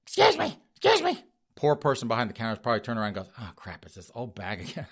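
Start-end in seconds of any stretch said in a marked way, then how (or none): 0.0-1.2 high squeaky granny demanding attention; falsetto old lady calling out. Retold or imitated. Wife calling worker. 3.4-4.9 much softer, lower grumble; worker groaning aside. Retold or imitated.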